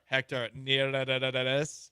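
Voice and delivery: Neris with a long trilled R, very slow; rolled R. Shown as a demonstration. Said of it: "Move over".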